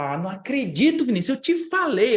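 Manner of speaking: high, loud exasperated scolding; retelling the landlord's irritated "I told you"; annoyed landlord. Retold or imitated. Síndico irritado repreendendo Vinícius, bem alto e agudo. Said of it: "E ficou bravo".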